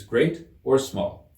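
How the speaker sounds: ordinary delivery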